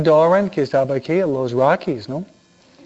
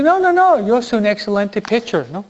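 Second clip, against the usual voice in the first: put-on boy protesting; 6 semitones higher than usual. Boy insists to his mother that he is a great pitcher, high protest. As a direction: kid proudly correcting his mom.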